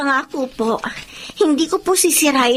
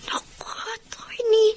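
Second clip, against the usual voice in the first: choked, crying whisper of gratitude; tearful whisper; 8 semitones higher, 7 dB quieter than usual. Acted. Girl thanks the attorney for the job, crying in a whisper. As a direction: whisper through tears, higher.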